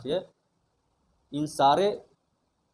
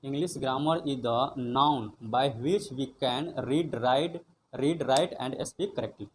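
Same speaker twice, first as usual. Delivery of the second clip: slow read-through; much slower than usual. Shown as a demonstration. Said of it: "reading whole definition slowly".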